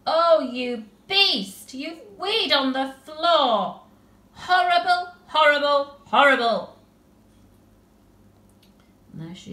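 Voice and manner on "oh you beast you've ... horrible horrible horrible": slow, much higher and louder, horrified; disgusted outburst. Acted. Tilly finds the puddle on the floor, horrified outburst. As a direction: shriek horrible three times in disgust.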